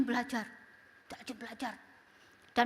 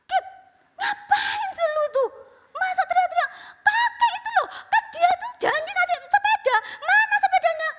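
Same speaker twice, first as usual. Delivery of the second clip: high loud scolding and laughing reply; shouting exchange; 8 dB louder than usual. Retold or imitated. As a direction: call across the room, exasperated. Exchange between mother and child, shouting. (mama menegur sambil berteriak)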